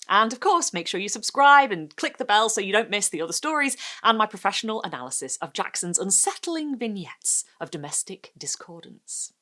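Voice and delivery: ordinary delivery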